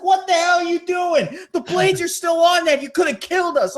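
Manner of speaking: mock furious yell; alarmed anger. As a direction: yell about the live blades. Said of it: "that's like".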